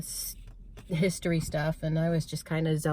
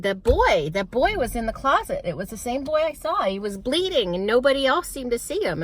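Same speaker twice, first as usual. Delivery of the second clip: put-on upset child; 7 semitones higher, 4 dB louder than usual. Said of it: "And I'm like".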